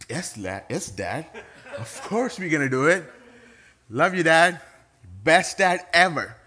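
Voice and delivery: put-on overeager high sing-song kids' voice; sarcastic eager kids. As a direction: gush like fake-eager children. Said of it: "I'm being sarcastic".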